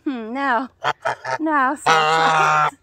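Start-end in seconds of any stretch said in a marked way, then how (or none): 1.4-2.8 drawn-out, low, loud call; slow loud call. Real, not acted. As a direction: stretch the name out loud. Calling the goose, drawn out.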